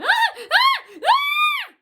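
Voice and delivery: imitating high piercing loud screams; mock screaming. Coworkers screaming at work, imitated as high loud shrieks. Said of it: "they're screaming and yelling the whole time".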